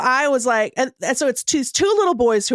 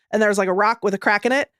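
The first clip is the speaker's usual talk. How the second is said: ordinary talk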